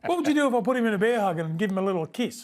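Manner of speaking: giggling as he teases about hugging the ref; laughing protest. Real, not acted. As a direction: laugh through the protest, pitch jumping up. Mock outrage over touching ref.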